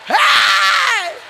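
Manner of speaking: loud high "hey"; ecstatic shout. Real, not acted. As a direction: shout out in joy.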